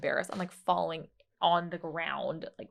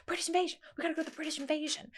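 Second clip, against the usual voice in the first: higher, fast eager mom imitation; imitating eager mom; 9 semitones higher, much faster than usual. A daughter imitating her mom pushing everyone toward the British Invasion, higher and fast. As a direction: mimic mom rushing the family along. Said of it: "she's like".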